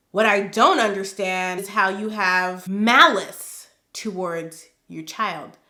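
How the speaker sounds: normal talking voice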